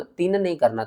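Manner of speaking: ordinary talk, nothing marked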